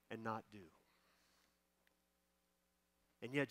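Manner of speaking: hushed, unhurried plea to Jesus; heartfelt prayer. Real, not acted. Leads a closing prayer.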